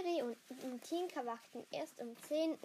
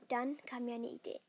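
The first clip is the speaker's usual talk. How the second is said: ordinary delivery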